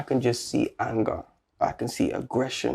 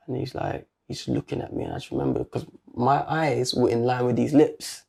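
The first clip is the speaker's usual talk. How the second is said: normal talking voice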